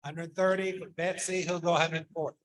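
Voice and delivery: brisk auction bid calling; auctioneer. Acted. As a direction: call the bids like an auctioneer. Calls bids at auction.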